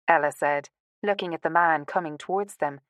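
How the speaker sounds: usual speaking voice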